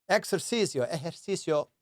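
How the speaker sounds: Ejercicio said the Spanish way; Spanish word. Shown as a demonstration. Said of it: "as you would say in Spanish".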